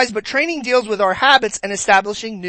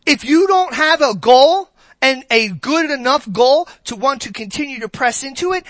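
ordinary delivery

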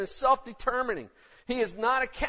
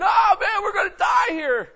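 loud, high, terrified yell; panicked scream; 9 semitones higher, 9 dB louder than usual. Retold or imitated. Servant screams to Elijah at the enemy army, terrified. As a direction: shriek that the army will kill them. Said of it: "Gehazi's screaming".

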